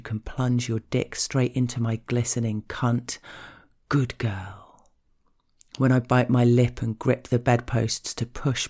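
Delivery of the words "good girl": lower, intimate murmur of praise; partner's low approving words, lightly voiced. Partner praising the speaker in bed, low approving praise.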